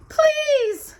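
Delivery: very high, loud desperate plea; begging. Acted. Cinderella locked in, begging her stepmother. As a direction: beg to be let out. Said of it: "Cinderella begged".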